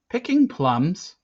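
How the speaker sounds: high, loud, drawn-out protest; defensive. A narrator's reading. Boy explaining grandma's tree climbing to an officer, defensive. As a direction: answer as if under accusation. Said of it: "I replied defensively".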